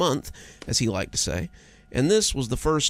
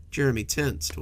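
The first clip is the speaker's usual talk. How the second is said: ordinary delivery, nothing marked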